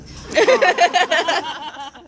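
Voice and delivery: bright burst of laughter; high laugh. Real, not acted. Laughs at the crowd.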